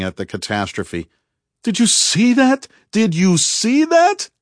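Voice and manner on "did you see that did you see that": high, loud, exhilarated shout; thrilled, high-pitched holler; excited yelling. A narrator's reading. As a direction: yell it in wild disbelief, stretching the repeat. Ari howling at the catastrophe.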